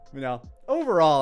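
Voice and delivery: ordinary talk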